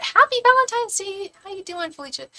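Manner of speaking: very high, singsong hello; cheery greeting. Real, not acted. Host greeting a viewer on Valentine's Day, high cheery voice. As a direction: lift the greeting into a high, playful pitch.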